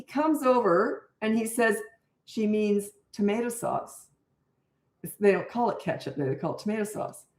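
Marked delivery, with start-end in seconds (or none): 2.2-7.2 calm manager. Retold or imitated. Manager explains calmly.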